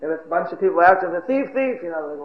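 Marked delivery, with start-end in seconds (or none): 1.2-1.8 imitated crowd cry. Bunch of people following, light high mock cry. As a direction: echo the chase call up high.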